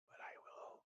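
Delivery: unvoiced menacing whisper; whispered threat. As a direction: whisper the threat mock-sweetly.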